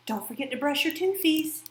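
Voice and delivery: a hint of a cooing mom; mock baby talk. Woman imitating her mom reminding her about teeth, cutesy. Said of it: "she'd also say".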